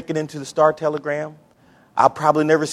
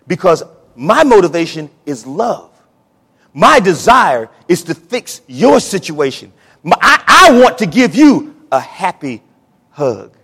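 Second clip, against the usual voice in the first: rising preaching; 7 dB louder than usual. Real, not acted. Preacher building the congregation toward God's love. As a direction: swell up as God's love pours out.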